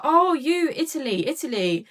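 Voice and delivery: loud, high mock heckle; imitated calling out. Train pest hails the woman he spotted, mock calling out. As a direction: call out like a pestering stranger. Man on train calls out to her.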